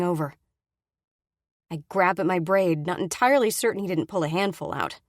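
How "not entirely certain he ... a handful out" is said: ordinary delivery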